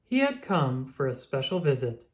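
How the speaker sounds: ordinary delivery, nothing marked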